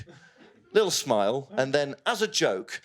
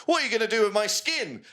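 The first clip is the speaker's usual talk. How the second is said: mock victim question; 8 semitones higher than usual. Victim asks the killer.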